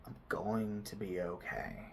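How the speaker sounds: quiet, half-believed "I'm going to be okay"; "I'm going to be okay" almost under the breath; soft mumbled mantra. Shown as a demonstration. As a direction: murmur the mantra without conviction. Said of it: "repeat it a bit as a mantra".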